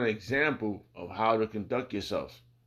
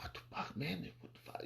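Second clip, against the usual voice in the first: hushed, breathless disbelief; shocked whisper; 5 semitones higher, 16 dB quieter than usual. Retold or imitated. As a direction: whisper it like you can't believe your eyes. Retelling his own shocked reaction.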